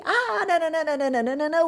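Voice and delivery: mock-Japanese babble from the delighted boss; imitating the interviewer. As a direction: mimic fast Japanese you don't understand. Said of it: "Aí ele".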